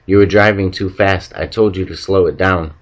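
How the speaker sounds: ordinary delivery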